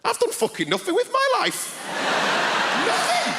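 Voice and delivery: high-pitched mock-anguished cry; hammed-up despair at full pitch; exasperated outburst. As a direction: wail it high, rising to a yelp. Outburst of realisation at the questions.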